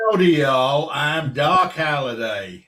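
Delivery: slow, drawn-out amateur Texan; showing off a shaky-quality Texan impression; put-on Texan accent. Shown as a demonstration. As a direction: have a go at a big Texan drawl. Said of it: "do my Texan accent for you".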